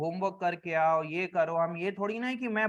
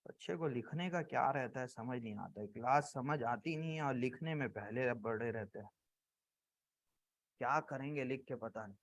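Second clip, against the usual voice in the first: low, soft grumble about the students; quiet grumbling; 8 semitones lower, 12 dB quieter than usual. Real, not acted. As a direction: grumble under your breath, low and soft. Teacher grumbles aside about students not writing.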